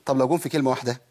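normal talking voice

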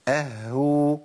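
"اهو" with a held double h, then long oo; doubled ha damma. Shown as a demonstration. Teacher modelling the sixth state, "اهو" with h doubled. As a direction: linger on the h inside "اهو". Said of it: "اللي هو حاله تشديد".

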